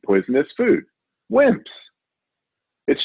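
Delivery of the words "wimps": scornful jeer. Acted. Host mocks picky eaters.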